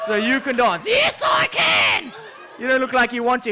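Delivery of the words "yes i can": drawn-out shout. Acted. Lion insisting he can dance.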